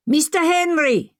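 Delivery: put-on high, drawn-out hail in an Indian accent; accented, high-pitched greeting call. A narrator's reading. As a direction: call out slowly to someone across the way. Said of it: "the man called".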